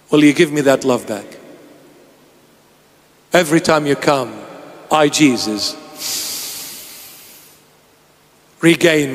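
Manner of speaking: hushed tender plea. Acted. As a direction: draw them in gently. Quiet closing of sermon.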